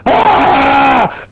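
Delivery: high, sustained mock scream; short wild yell, imitating a madman; imitated madman scream. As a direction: let out a madman's "Ah!". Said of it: "he just goes".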